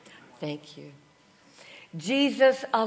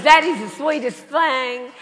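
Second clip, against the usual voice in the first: higher, louder Texan gushing; Southern Texan accent; 4 semitones higher, 6 dB louder than usual. Retold or imitated. Texan woman delighted by her seatmate, exaggerated Southern accent. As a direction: gush like a hammed-up Texas woman. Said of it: "this wonderful Southern".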